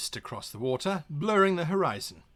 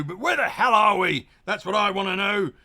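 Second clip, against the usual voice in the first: angry; 3 semitones higher, 6 dB louder than usual. Acted. Captain demands location.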